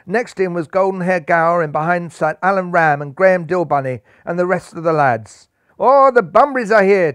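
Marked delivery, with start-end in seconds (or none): 5.8-7.1 higher, louder joyful cheer. A narrator's reading. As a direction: cheer as the friends fly in. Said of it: "cheered Rajban".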